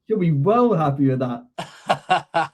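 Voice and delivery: laughing. Real, not acted. Laughing while teasing co-host.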